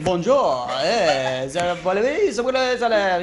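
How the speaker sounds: a light hammed-up French accent; mock French accent. A Frenchman collecting charity door to door, in a mock French accent. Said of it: "and they say".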